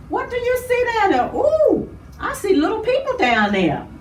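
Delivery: high, quick, awed question; excited wonder. Pretend. Host pretending to look down from the sky, high excited wonder. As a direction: ooh high at the view below. Pretend looking down while flying.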